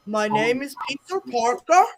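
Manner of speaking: imitated Indian-accented scam caller; retold caller in mock Indian accent; bad Indian accent. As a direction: put on a clumsy Indian accent. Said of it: "worst Indian accent ever".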